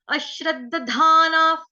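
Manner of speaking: drawn-out model of अश्रद्धाना; pronunciation demo. Shown as a demonstration. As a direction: chant अश्रद्धाना, stretching dhaa-naa. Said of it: "सुनो ध्यान से".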